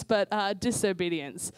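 ordinary talk, nothing marked

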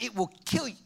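ordinary talk, nothing marked

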